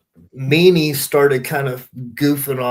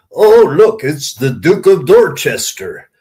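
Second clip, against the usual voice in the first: playful jab, punched out loud; mock teasing greeting; 7 dB louder than usual. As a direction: tease a late arrival like a smart-aleck agent. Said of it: "was being a wise ass with him".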